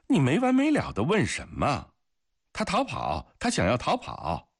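high-pitched, exasperated comeback; irritated retort. A narrator's reading. Guard answers his accuser about the escaped prisoner, irritated. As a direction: push back against the questioning. Guard snaps back at questioner.

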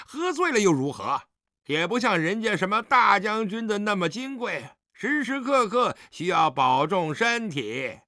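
high-pitched, sneering jibe; tipsy, scornful dig; taunting. Acted. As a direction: needle the general with mock deference. Drunk son taunts the general.

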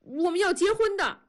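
high-pitched, determined blurt; sudden high-voiced declaration. A narrator's reading. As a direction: blurt it out high after faltering. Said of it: "突然又高了起来".

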